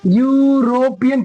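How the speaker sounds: यूरोपियन stretched into यू, रो, पियन; sounded out by parts. Shown as a demonstration. Teacher asks which sounds occur, यूरोपियन drawn out by syllables. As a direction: draw out the opening Y sound of यूरोपियन. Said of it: "क्या क्या आ रहा है?".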